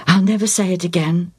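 usual speaking voice

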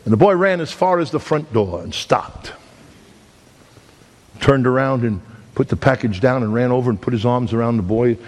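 ordinary talk

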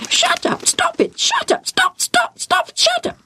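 very high, rapid-repeat squawks; screaming parrot. A narrator's reading. Parrot flying round the tent, screaming. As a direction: parrot shrieking the same orders over and over.

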